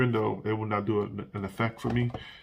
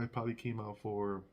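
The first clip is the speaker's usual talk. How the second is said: usual speaking voice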